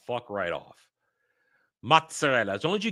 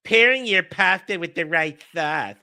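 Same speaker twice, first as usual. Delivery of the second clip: lisping mimicry, louder; mocking repeat; 2 semitones higher, 5 dB louder than usual. Streamer mocking the video's sauce-pairing rule, mocking lisp. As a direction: parrot the line back in a lisping put-on voice.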